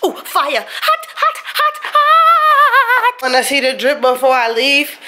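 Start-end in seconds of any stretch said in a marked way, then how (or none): 0.2-3.2 sharp high yelps of hot, hot; burning feet yelps. Real, not acted. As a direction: yelp like your feet are on fire. Feet burning after spraying them.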